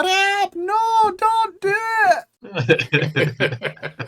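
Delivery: very high, drawn-out mock wailing; impersonated wife. Shown as a demonstration. Showing friends how to do Ab's wife, high put-on voice. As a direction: squeal it way up high as someone's wife. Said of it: "if you wanna do an ab".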